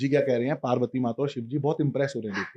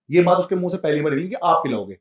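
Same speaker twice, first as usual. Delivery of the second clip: ordinary delivery, nothing marked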